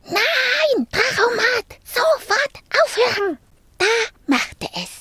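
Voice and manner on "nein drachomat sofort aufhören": high, urgent cries to stop; panicked shouting. A narrator's reading. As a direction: shout at a runaway machine, pitched up. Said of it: "Rüdiger und das kleine Mädchen riefen".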